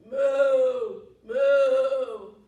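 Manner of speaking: very loud, long sung moos; Mommy Cow's moo. Pretend. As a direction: moo loudly like a mother cow calling. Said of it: "gonna call 'em with a moo".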